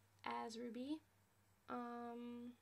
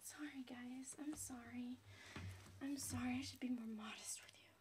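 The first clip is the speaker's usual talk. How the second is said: whisper; 6 dB quieter than usual. Real, not acted. Apologizing, whispered.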